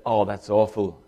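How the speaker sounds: ordinary talk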